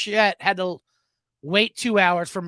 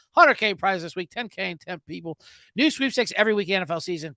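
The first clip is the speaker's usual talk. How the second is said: fast, loud ad read; 5 dB louder, faster than usual. Acted. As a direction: rattle off the sponsor copy. Reads a sponsor ad.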